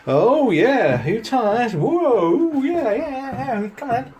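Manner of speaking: high, bouncy coaxing for the dog; excited pet talk. Acted. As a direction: cheer the dogs on. Greeting the excited dogs.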